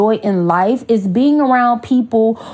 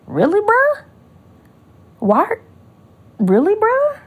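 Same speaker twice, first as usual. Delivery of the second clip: higher, rising disbelieving really bruh; incredulous mock; 11 semitones higher than usual. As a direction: play the unimpressed newcomer. Newcomers' looks as she sings.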